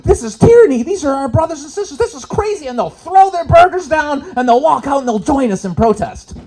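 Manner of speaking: high, loud mock outrage; imagined outraged Canadians. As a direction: ham up the shocked diners.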